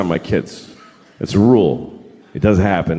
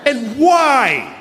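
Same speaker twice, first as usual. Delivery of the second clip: escalating comedy rant; 9 semitones higher than usual. Real, not acted. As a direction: build the rant to a peak.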